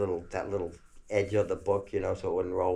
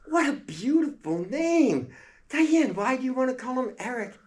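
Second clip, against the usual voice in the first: delighted mother; 5 dB louder than usual. Retold or imitated. Mother praising daughter's choice, high gushing voice. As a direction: gush over the name.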